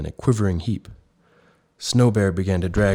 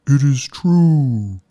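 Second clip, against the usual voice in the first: very slow, high, louder whale sigh; 7 semitones higher, 7 dB louder, much slower than usual. A narrator's reading. Whale admits to Snow Bear it is true, sighing. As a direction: let it out on a long sad sigh. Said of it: "sighed the whale".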